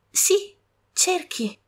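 high, very slow and tender; Mary gently urging; gentle. Acted. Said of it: "ripeté Mary con dolcezza".

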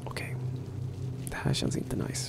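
hushed whisper in the dark. Real, not acted. Creeping through a horror level, scared whisper. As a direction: whisper so nothing hears you.

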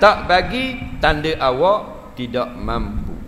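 ordinary talk

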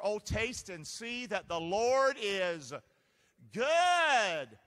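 slow "taste and see" building to a loud, high "good"; rising preacher's call, peaking on "good"; building scripture call. Shown as a demonstration. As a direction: start measured, then shout "good" out. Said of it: "Say that scripture".

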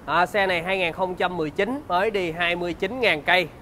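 bright, upbeat sales pitch; slick used car salesman. Acted.

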